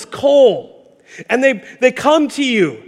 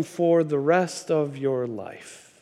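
lower, much softer, slow; grave verdict; 6 semitones lower, 10 dB quieter, slower than usual. Retold or imitated. The Lord telling Isaiah his fate, soft and grave. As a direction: drop it down low and slow.

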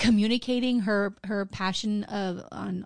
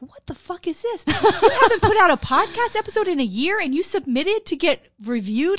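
higher and louder, a mock rant; imitating an angry reviewer; 7 semitones higher, 6 dB louder than usual. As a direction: rant like a harsh podcast critic tearing into them. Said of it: "he starts in on these people".

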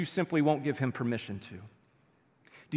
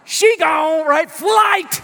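very high, booming holler; comic, full-voiced yell; gleeful shout; 13 semitones higher, 12 dB louder, much slower than usual. Real, not acted. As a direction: holler it out to the whole room. Preacher exclaims at her running away.

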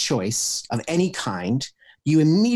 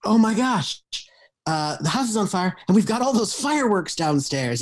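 higher-pitched playful escalation; mock excited alarm; 8 semitones higher than usual. Improv partner builds on the fire offer, in mock excited alarm. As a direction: pile on the pretend panic eagerly. Acts out escalating yes-and reply.

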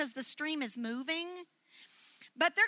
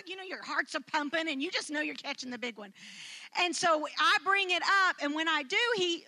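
ordinary talk, nothing marked

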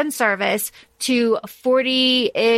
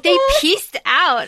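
slow, higher, mock-deadpan quote; imitating Cass; 7 semitones higher, 3 dB louder, slower than usual. Host quotes Cass's line, slow and hammed. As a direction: drag it out like Cass would. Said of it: "He's like".

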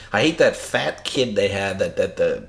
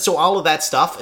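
normal talking voice

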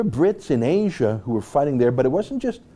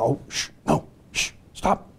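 shushing commander; 2 semitones higher, 3 dB quieter than usual. Retold or imitated. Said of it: "some commander was on the phone".

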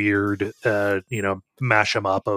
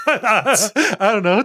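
laughing through the words; 11 semitones higher, 4 dB louder, much slower than usual. Real, not acted. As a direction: breaks into a high laugh. Laughing under mock gun threat.

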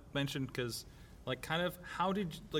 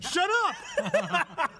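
excited; 14 semitones higher, 5 dB louder than usual. Real, not acted. Host reacts to teasing.

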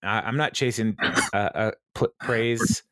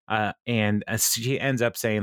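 ordinary talk, nothing marked

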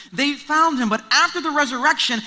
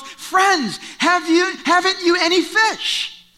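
raised, higher call to far-off fishermen; calling out across water; 7 semitones higher, slower than usual. Acted. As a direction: call out the question to the boat a hundred yards away. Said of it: "he shouts a question".

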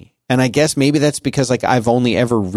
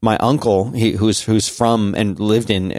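ordinary talk, nothing marked

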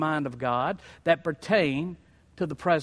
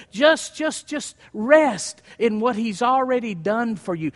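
louder, rising preacher cadence hammering each phrase; preaching at full force; 5 semitones higher, 5 dB louder than usual. Acted. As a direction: drive it home to the congregation. Pastor recounts reply, preaching rises.

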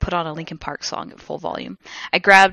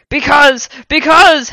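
loud, high repeated cry; dramatic because; 8 semitones higher, 10 dB louder, slower than usual. A narrator's reading. Hagrid declaring in mid-air, loud and dramatic. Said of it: "Hagrid said, and he paused ... dramatically".

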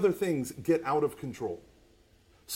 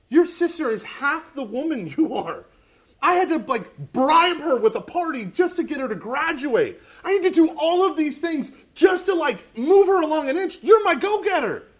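father's outburst; 9 semitones higher, 7 dB louder than usual. Retold or imitated. Father praises daughter, voiced.

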